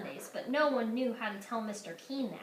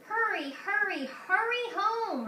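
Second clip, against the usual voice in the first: put-on high, slow little-boy call; small brother calling her home; 9 semitones higher, 4 dB louder, slower than usual. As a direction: call after her, pleading. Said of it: "her brother called".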